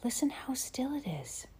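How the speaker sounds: hushed unvoiced whisper. A narrator's reading. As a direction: breathe it in a whisper. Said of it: "Carrie whispered".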